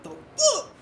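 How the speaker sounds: sudden high squeal of disbelief; incredulous viewer. Retold or imitated. Impatient viewer shrieking at slow progress. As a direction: shriek it, baffled and fed up.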